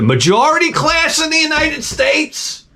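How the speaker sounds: squealed comic outburst; high-pitched exclamation. Real, not acted. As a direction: voice leaps way up high.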